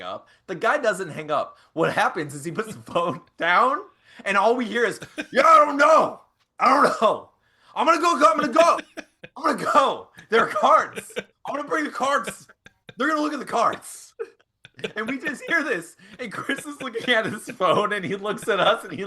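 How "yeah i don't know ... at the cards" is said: high, loud, rushed rambling; frantic rambling. Retold or imitated. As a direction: babble it out in a panic. Caller overheard rambling, retold.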